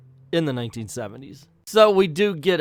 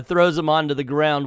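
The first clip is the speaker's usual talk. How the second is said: ordinary talk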